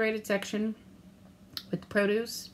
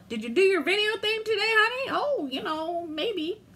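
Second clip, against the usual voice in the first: higher, sing-song teasing exchange; playful mock dialogue; 10 semitones higher than usual. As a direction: play both voices lightly. Acting husband and her reply.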